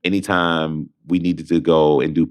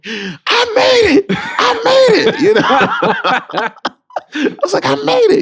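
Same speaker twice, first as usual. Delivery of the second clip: much higher, louder, slow and laughing; much higher laughing shriek to the roommate; screaming with joy; 6 dB louder than usual. Retold or imitated. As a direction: shriek it into the sleeping roommate's room. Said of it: "I was screaming".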